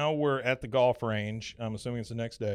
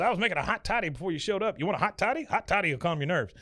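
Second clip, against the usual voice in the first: higher, quick blustery boss voice; hospitable Buck; 7 semitones higher, faster than usual. Retold or imitated. Buck offering Bobby a hot toddy, higher blustery voice. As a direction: offer it briskly, up high.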